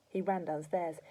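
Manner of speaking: plain reading voice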